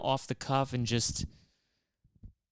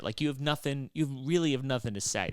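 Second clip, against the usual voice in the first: usual speaking voice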